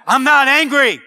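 mock shouted angry denial; hammed-up angry protest. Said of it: "you don't normally talk like that".

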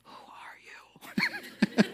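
airy unvoiced whisper; hushed startled whisper. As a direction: breathe it, barely audible. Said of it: "Was it like a whisper?".

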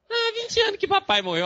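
very high, loud mock wail; mock crying. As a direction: imitate a patient bawling over an old loss. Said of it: "Chorando desse jeito". (choro imitado, bem agudo e alto)

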